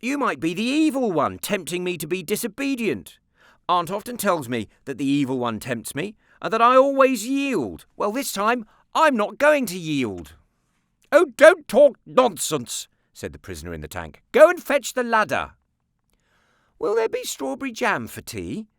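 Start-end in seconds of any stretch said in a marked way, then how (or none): 0.0-10.3 put-on higher, louder boy's voice; defiant boy. A narrator's reading. Nicholas defies aunt. 11.1-12.9 slow, high, loud scold; cross aunt. A narrator's reading. Aunt loses patience. 14.3-15.5 cross aunt. A narrator's reading. Aunt orders him. 16.8-18.7 put-on higher, innocent-sounding boy. A narrator's reading. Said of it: "asked Nicholas innocently".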